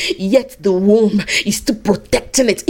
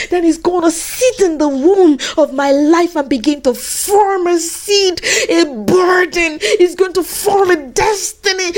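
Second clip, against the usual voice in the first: fervent preaching; 8 semitones higher than usual. Acted. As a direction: preach it out with mounting passion. Preaches at full force.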